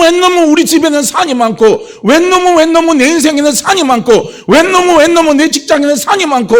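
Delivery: high, loud mock grumbling about everyone else; mock complaining. Preacher mimics a believer blaming others, mock grumbling. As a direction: whine like someone blaming the world.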